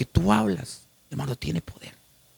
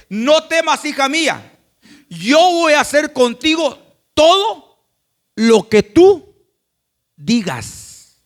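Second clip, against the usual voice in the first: high, loud and slow, a proclaiming voice; Christ's loud promise to his church, drawn out slowly; Christ speaking; 8 semitones higher, 9 dB louder, slower than usual. Acted.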